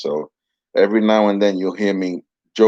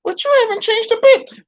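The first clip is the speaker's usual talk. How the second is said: higher cheeky puppet jab; 4 dB louder than usual. Acted. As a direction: tease him in the dummy's voice. Puppet teasing the ventriloquist.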